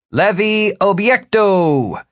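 high, slow, drawn-out magical command; spell incantation. Acted. Wizard shoving the big thug away by magic, commanding. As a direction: cast the spell, stretching each word high.

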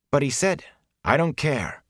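protest, then give it up; worked-up, dismissive retort; agitated protest. Acted. Matt's agitated objection cut short.

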